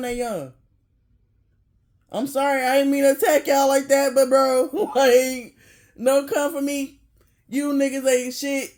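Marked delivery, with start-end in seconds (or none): 2.1-7.0 louder, laughing half-apology to the viewers. Real, not acted. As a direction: yell it out between laughs, not really sorry.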